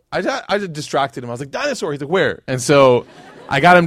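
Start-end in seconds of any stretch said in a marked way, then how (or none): 1.5-1.9 much higher, bright call of dinosaur; sudden high excited shout to a child; excited distraction. Retold or imitated. 2.1-2.4 higher, louder eager question; a kid suddenly excited; louder child's eager where. Retold or imitated. Son eager.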